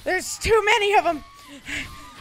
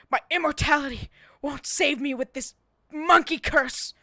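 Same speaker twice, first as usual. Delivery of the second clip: usual speaking voice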